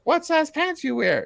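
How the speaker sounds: high-pitched, slow mock woman's voice; put-on high female voice; woman's flirty line. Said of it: "she's like".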